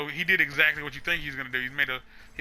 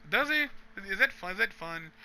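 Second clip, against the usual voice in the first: high, skeptical "does he?"; doubtful mock question; 6 semitones higher than usual. GM second-guessing his own plot, high skeptical doubt.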